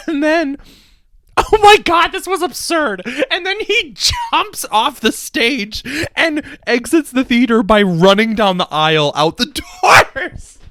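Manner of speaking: high, laughing outrage; giddy, can't-believe-it ranting; excited disbelief. Real, not acted. Laughing outrage at phantom's antics.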